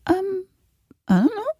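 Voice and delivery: high, hesitant little answer; sheepish uncertainty. Retold or imitated. Client caught out by therapist's question, sheepish and unsure. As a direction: falter in a high voice, rising at the end.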